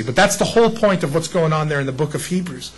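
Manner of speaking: ordinary talk, nothing marked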